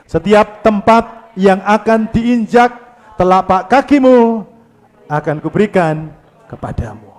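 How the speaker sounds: steady loud congregational reading. Shown as a demonstration. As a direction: read it aloud together. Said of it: "Yuk kita baca dengan suara keras".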